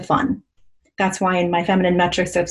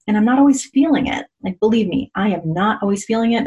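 normal talking voice

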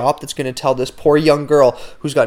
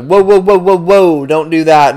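loud, high whoa to stop her; alarmed shout; 5 semitones higher, 8 dB louder than usual. Retold or imitated. Shouting at the receptionist to stop before she plugs in wrong. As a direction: yell to stop a mistake. Stopping her from reversing the cables.

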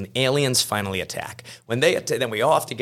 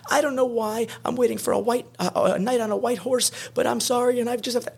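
mock distraught woman, much higher voice; tearful girlfriend. Girlfriend fleeing the car, hammed-up high tearful voice. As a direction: squeak it out like a hammed-up heartbroken girlfriend. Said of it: "and she says".

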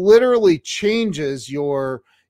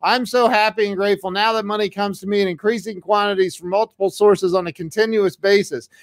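higher, fast chanted affirmation; eager sped-up recital of the affirmation; excited retelling; 6 semitones higher than usual. Retold or imitated. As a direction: rattle it off like a mantra, keyed up. Retelling friend's excited drive.

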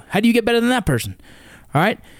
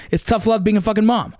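ordinary talk, nothing marked

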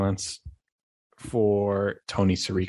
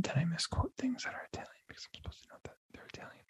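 whispered aside; 7 semitones higher, 14 dB quieter, faster than usual. Real, not acted. Embarrassed aside about misquoting Italian.

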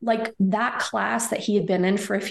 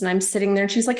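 usual speaking voice

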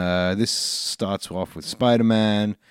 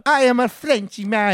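much higher, louder cartoon French-Italian accent; mock French accent; 10 semitones higher, 5 dB louder than usual. Joking about a French villain for the frog, hammed-up French accent. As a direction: announce yourself like a silly cartoon Frenchman. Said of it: "You broke accents".

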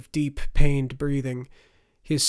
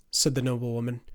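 ordinary delivery, nothing marked

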